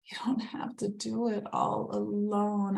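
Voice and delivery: the same line breathed as a whisper; whispered Mother Earth. Pretend. Mother Earth comforting the listener, in a whisper. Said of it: "And it's saying".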